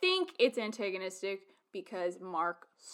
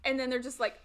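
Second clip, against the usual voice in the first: usual speaking voice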